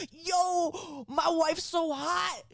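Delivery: high, slow, hammed-up kid bragging; mock party-animal child voice; imitating redheaded child. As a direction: stretch it out like a boasting kid. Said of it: "this nine-year-old redheaded child".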